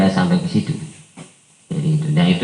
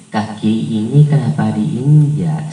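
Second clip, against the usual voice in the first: polite Javanese from Solo, hiding anger; gentle Javanese manner; slower than usual. Shown as a demonstration. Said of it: "logat orang Solo dan Jogja".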